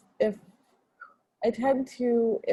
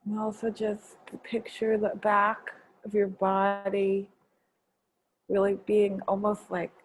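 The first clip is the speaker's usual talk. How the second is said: guided meditation. Acted. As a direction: gently guide them inward. Leads a gratitude meditation.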